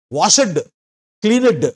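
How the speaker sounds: వాషెడ్ with a separate stressed "ed" syllable; క్లీనెడ్ with its last "ed" syllable pushed up; imitating the learner's heavy -ed. Shown as a demonstration. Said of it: "ఈ ఈడీకి ఎక్కువగా stress ఇస్తుంటారు".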